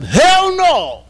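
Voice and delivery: high, loud shouting protest; a preacher's outraged cry for fairness; impassioned shout. Retold or imitated. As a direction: yell it out, jumping up to object. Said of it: "Kamaluddin jumped up".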